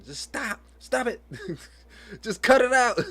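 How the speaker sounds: high, loud scolding with a laugh in it; mock scolding. Mom acting out scolding her daughter, high and laughing. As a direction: snap at the kid, half in jest. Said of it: "Not with her like that".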